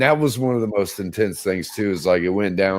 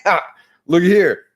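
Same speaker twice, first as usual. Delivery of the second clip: loud gleeful gotcha; triumphant yell; gloating shout; 11 semitones higher, 7 dB louder than usual. Retold or imitated. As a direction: crow over the find, loud and high. Mocking others' mistake.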